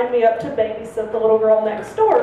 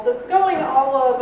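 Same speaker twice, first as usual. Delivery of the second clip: ordinary delivery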